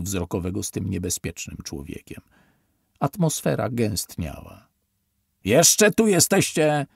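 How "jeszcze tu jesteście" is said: slow, loud, high roar. A narrator's reading. Officer roaring at survivors still in the tent, loud roar. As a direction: bellow at them to get out. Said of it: "ryknął".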